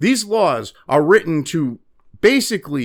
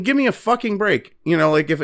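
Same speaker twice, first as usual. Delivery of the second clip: ordinary delivery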